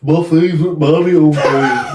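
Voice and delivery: loud, slow Brummie drawl; comic high Birmingham accent, laughing. Shown as a demonstration. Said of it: "Can you do a Brummy accent".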